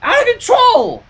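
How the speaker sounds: high exasperated answer; exasperated cry. A narrator's reading. Applejack answers Rainbow, high and exasperated. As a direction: throw it back at her sharply.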